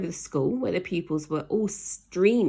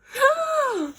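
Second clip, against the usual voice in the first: high gasping shriek of fright; frightened girl's gasping scream; startled scream; 5 dB louder than usual. Acted. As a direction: gasp and shriek as if something leapt out. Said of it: "screamed Jemima".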